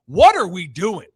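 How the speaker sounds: loud, exasperated yell at other drivers; retold yelling. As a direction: yell it out in frustration. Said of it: "I'm just yelling".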